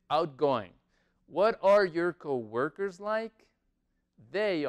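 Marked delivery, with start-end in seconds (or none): 0.1-0.7 the two parts joined up quickly; natural-speed model of the word; faster demonstration. Shown as a demonstration. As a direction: say it as one quick connected word. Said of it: "Más rápido se dice".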